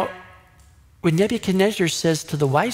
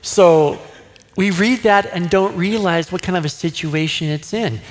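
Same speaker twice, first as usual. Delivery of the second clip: ordinary talk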